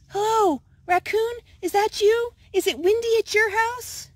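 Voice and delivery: high, nervous small animal voice; a jittery little skunk calling a friend; anxious little Skunk. Acted. Skunk phoning Raccoon during the storm, high and worried.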